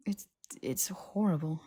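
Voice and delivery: whispered, shaken; Harry telling Hermione how horrible the fits are, whispering; hushed horror. Acted. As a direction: whisper it, haunted. Harry recalling Malfoy's pain.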